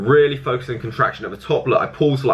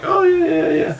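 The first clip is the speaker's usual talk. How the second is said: much higher, hammed-up lifter's yeah yeah; mock gym lifter; slower than usual. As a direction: parody a guy grunting through his curls. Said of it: "going to the gym and going like".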